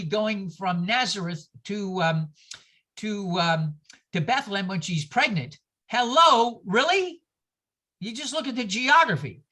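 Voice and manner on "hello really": incredulous hello. Real, not acted. Mocking the implausible Nazareth journey.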